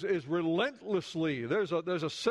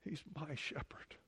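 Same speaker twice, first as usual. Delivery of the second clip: near whisper; 2 semitones lower, 11 dB quieter than usual. Real, not acted. Near-whispered closing affirmation.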